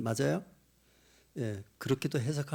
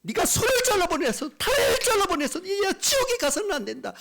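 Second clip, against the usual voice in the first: high, loud, impassioned plea; God crying out; 6 dB louder than usual. Retold or imitated. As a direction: shout urgently, easing off on the last words. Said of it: "외치시는 거예요". (높고 절박하게 외치는 하나님)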